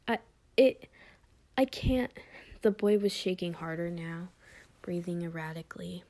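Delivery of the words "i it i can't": put-on high, trembling child's voice; a frightened kid choking out words; scared little boy.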